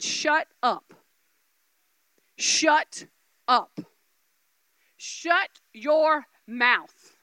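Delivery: slow, spaced-out blunt order; blunt command. Retold or imitated. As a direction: imitate the Lord, each word dropped with a pause. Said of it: "He's just real blunt".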